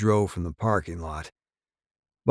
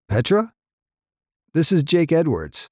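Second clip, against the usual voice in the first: anxious caller; 8 semitones higher, 4 dB louder than usual. Acted.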